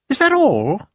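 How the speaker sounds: put-on a disappointed girl asking; Sophie asking. A narrator's reading. Sophie to the BFG, asking if they're done.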